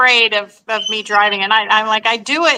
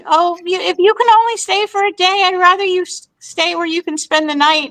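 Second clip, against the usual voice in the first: higher voice for a quoted aunt; retold relative's request, pitched up; imitating aunt; 8 semitones higher than usual. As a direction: lift the pitch to play the aunt. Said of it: "she was like".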